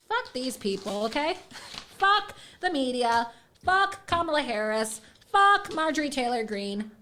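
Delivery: normal talking voice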